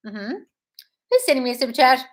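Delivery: ordinary talk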